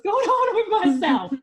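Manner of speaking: high, loud, through laughter; words bursting out with a laugh. Real, not acted. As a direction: laugh at your own bewilderment. Laughs at own bewilderment.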